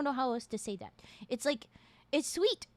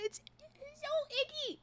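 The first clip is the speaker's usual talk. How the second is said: high voice around a mouthful; juggling candy in the mouth; mouth full; 12 semitones higher than usual. Real, not acted. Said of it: "there's some in my teeth".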